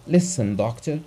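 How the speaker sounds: ordinary delivery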